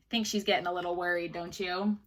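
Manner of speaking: plain reading voice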